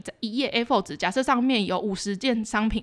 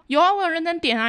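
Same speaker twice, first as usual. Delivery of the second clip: offhand, higher-pitched mock of a careless coworker; slacker attitude; 7 semitones higher, 3 dB louder than usual. Imitating the lazy coworker brushing off the complaint, careless and offhand. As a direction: toss it off like you couldn't care less. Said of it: "你知道吊儿郎当的那个".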